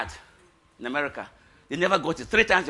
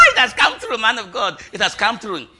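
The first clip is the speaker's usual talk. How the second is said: loud laughing shout, high; excited news; 7 semitones higher, 15 dB louder than usual. Retold or imitated. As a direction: burst out with joy. Excited phone news retold.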